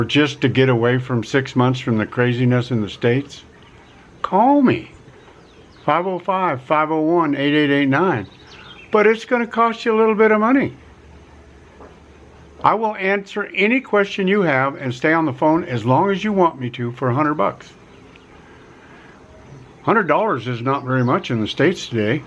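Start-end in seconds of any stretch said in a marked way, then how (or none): none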